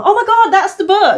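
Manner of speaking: high, loud thrilled exclamation; excited recognition. Retold or imitated. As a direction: spot the book and squeal with joy. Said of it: "you go".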